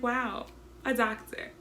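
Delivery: ordinary talk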